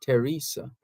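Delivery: "Teresa" begun with a plain T; "Teresa" with the H silent. Shown as a demonstration. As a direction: say "Teresa" as teh-REE-sa, no th. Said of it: "you don't pronounce it".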